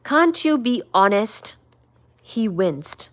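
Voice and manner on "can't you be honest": loud, pleading outburst; raised, anguished appeal; crying out. A narrator's reading. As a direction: burst out at him, begging for honesty. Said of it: "Eleanor cried out".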